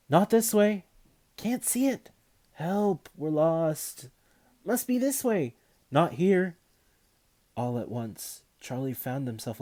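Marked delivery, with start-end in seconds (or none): 0.1-6.6 higher, louder calls through the woods; calling out. A narrator's reading. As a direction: call to friends lost in the dark. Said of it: "calling to each other as they searched".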